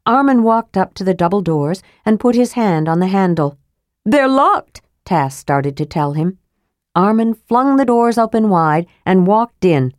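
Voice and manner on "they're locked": kender voice. A narrator's reading. Kender tries to warn.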